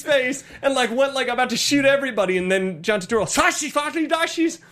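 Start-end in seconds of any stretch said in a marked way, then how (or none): 3.2-4.6 high fake-German gibberish; mock fake German. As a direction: rattle off made-up German words in a high voice. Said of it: "speaks in what sounds like fake German".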